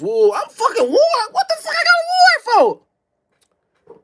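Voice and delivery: half-laughing, half-crying outrage at the warrant; disbelieving shout. Retold or imitated. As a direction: shriek it in shocked disbelief. Learning he has a warrant.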